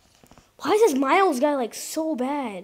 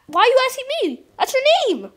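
much higher, louder incredulous protest; exasperated; 11 semitones higher, 5 dB louder, faster than usual. Real, not acted. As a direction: throw up your hands, why ask me. Exasperated at friend's question.

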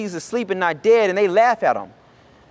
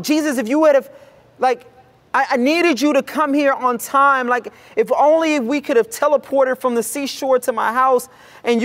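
higher, pained lament; anguished father; 6 semitones higher than usual. Acted. Jairus blaming Jesus for coming too late, anguished. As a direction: let it crack with regret.

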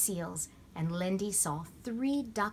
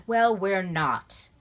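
loud, slow and firm; firm interruption; 9 dB louder, much slower than usual. A narrator's reading. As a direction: cut them off with authority. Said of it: "Ben interrupted firmly".

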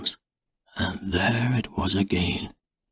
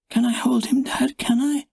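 put-on much higher child's voice begging; eager little girl; 13 semitones higher than usual. A narrator's reading. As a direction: ask Dad like an excited small child. Little girl asks her dad.

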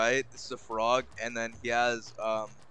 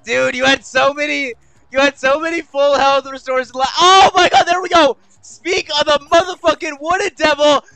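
loud, high-pitched rant turning to relief; worked-up shouting; 13 semitones higher, 13 dB louder than usual. Real, not acted. Gamer spotting a health restore, worked-up shouting. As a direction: yell it all out at full excitement.

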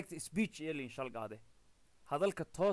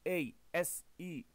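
slow spelling out; slower than usual. Shown as a demonstration. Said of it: "hingaadiye na ata I".

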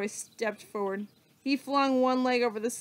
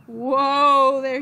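calling out whoa, much louder; loud cowboy call; 3 semitones higher, 10 dB louder than usual. Acted. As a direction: rein in a horse, loudly. Calming the galloping pig.

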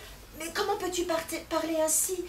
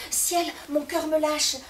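loud, high begging cry; husband shouting after his departing wife; desperate pleading; 4 semitones higher, 6 dB louder than usual. Acted. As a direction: call her back desperately. Husband begs wife back.

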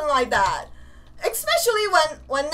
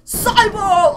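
ordinary talk

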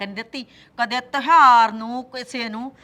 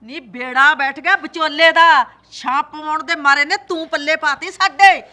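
angry cursing; 2 semitones higher, 8 dB louder than usual. Acted. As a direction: berate her loudly. Mother-in-law curses matchmaker. (ਸੱਸ ਦਾ ਵਿਚੋਲੇ ਨੂੰ ਉੱਚੀ ਕੋਸਣਾ)

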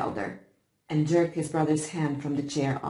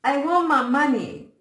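high, loud tearful cry; 9 semitones higher, 8 dB louder than usual. A narrator's reading. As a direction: wail it out for your money. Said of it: "cried the boy".

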